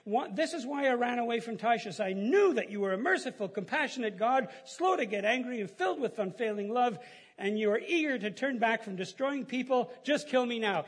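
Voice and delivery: a preacher hamming up Jonah's bitter complaint; Jonah complaining. Retold or imitated. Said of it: "he complained to the Lord about it".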